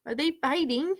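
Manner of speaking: usual speaking voice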